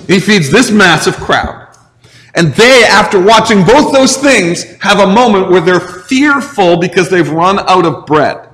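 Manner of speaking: ordinary delivery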